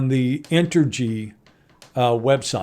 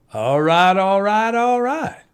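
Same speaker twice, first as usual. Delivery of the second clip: put-on drawl climbing in pitch with each repeat; mock Texan catchphrase; 5 semitones higher than usual. Host celebrates the sexy Texan accent, in a playful put-on voice. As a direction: play up a Texan catchphrase, rising each time.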